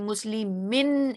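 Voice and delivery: मुस्लिमिन said slowly with a clear final नून; slow clear demo. Shown as a demonstration. Teacher shows students the नून sound, slow demo. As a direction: draw out मुस्लिमिन and land on the नून. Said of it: "इसमें भी हमें नून sound आ रही है".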